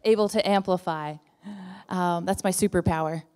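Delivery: projecting loud without a mic; New Jersey voice. Shown as a demonstration. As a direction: boom it out to fill the room. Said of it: "That's my New Jersey voice".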